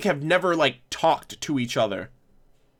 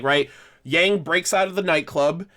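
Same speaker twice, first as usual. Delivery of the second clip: ordinary talk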